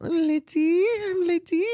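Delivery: high falsetto mock hostess; ladyboy voice. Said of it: "like, heighten their voice".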